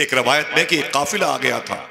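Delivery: ordinary talk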